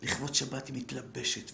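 normal talking voice

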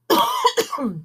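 dry throat cough; real cough mid-read; coughing fit. Real, not acted. Reader coughs.

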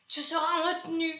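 lightly voiced strict supervisor; stern teacher. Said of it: "Falou assim, ó". (voz de inspetor severo, leve)

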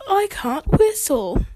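high, slow cartoon British accent; Peppa Pig. Host quoting the cartoon to listeners, high mock British accent. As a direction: imitate a little British cartoon pig, drawn out. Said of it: "and she says".

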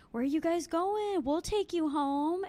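lightly mock a pushy pickup line; imitating men calling out. Voicing men calling from car.

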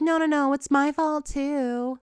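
imitated eager apology; pleading Cassie. Cassie answering Nate.

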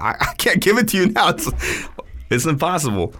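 high-pitched, stammering mock protest; imitated flustered reply. Overwhelmed cook answering the yelling chef, imitated high fluster. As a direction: act out an overwhelmed cook pleading back.

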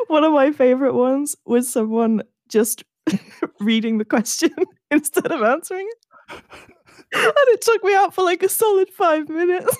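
talking through real laughter, pitch climbing high; laughing. Real, not acted. Friend recalling a favorite meme to co-host, helplessly laughing. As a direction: crack up as you tell it.

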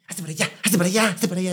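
high, fast cranky grandma shooing someone off; impatient old woman telling someone to move; grumpy old lady. Retold or imitated. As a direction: snap at them like a bedridden old woman. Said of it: "Esos viejitos enojados".

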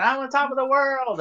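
high, exultant play-acted call; mock triumphant cry. Friend on top of the cruise ship, high mock-exultant cry. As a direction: sing out high in pretend triumph.

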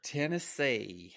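drawn-out put-on Southern Tennessee; slow, hammed-up Southern twang; bad Southern accent. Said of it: "How was my Southern accent?".

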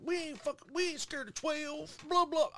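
a mocking high stoner brag. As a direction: mimic the careless stoners, high and silly. Mocking the potheads' bravado.

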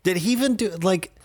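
high-pitched disbelief at the movie; retelling his own baffled reaction, voice climbing high; incredulous. Retold or imitated. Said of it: "I was like".

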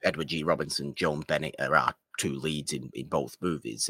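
ordinary talk, nothing marked

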